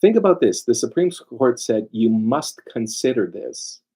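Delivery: ordinary talk, nothing marked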